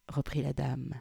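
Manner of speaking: usual speaking voice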